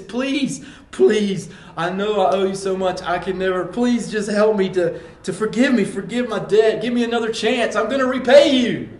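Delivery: high, loud desperate pleading; pleading servant, rising to a loud cry; begging servant. Acted. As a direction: beg the king for mercy, voice climbing. Servant begging king, acted plea.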